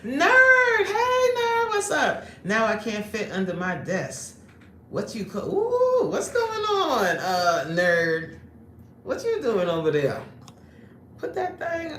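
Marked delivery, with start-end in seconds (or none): 0.0-10.2 excited call-out. Acted. Calls out to a viewer.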